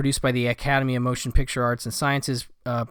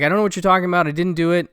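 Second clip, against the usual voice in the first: raised, louder protest of innocence; indignant denial; 4 semitones higher, 5 dB louder, much faster than usual. Retold or imitated. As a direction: push the denial out, louder and higher. Accused man denies killing cop.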